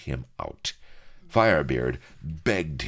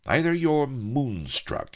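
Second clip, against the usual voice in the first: growled; 13 semitones higher, 4 dB louder, slower than usual. A narrator's reading. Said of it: "he growled".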